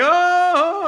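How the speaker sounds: very high squealed oh; a delighted little squeal; imitating the elevator crowd reacting to his joke; amused reaction. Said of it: "And they're like".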